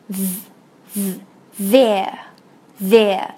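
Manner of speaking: THERE slowly, opening on a buzzed tongue-between-teeth sound; voiced TH. Shown as a demonstration. Teacher shows tongue between teeth, voiced TH. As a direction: isolate the buzz, then say THERE low and slow. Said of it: "这个音是上齿和下齿咬着舌尖".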